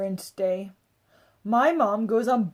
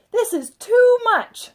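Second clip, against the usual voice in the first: high, loud, slow exasperated protest; a mother who has had enough; exasperated objection; 13 semitones higher, 10 dB louder, slower than usual. A narrator's reading. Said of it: "my mom protested".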